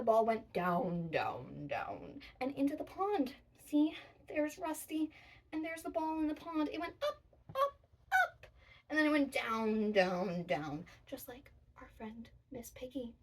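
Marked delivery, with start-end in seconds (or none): 0.0-2.2 falling pitch. Acted. Acting out ball falling. 7.0-8.5 rising high. Acted. Acting out ball going up. 8.9-10.8 falling down. Acted. Acting out ball falling.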